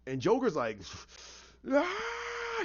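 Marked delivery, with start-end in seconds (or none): 1.7-2.6 long, very high strained scream, held back in volume; imitated scream. Storyteller as Joker left behind, thin high screaming. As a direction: mimic a villain's drawn-out shriek without full power. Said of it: "he's screaming".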